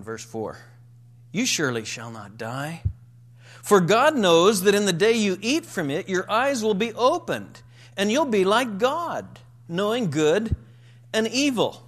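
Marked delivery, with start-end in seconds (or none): 1.3-11.8 louder and higher, bold tempting serpent; flat contradiction. Retold or imitated. Preacher voicing the serpent contradicting God, emphatic. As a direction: proclaim it as a sure promise, louder. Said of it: "flatly contradicting".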